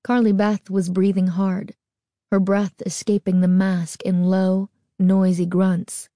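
usual speaking voice

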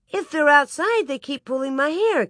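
put-on high-pitched, complaining little girl; protesting child. A narrator's reading. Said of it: "Carrie protested".

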